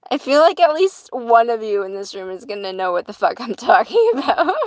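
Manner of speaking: loud, laughter breaking into the sentence; laughing while talking. Real, not acted. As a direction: crack up as you finish. Still cracking up over parrot video.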